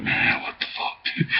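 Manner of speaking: breathless whisper of disbelief; whispered reaction. Retold or imitated. His own stunned reply to the yelling strangers, whispered. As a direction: whisper it under your breath.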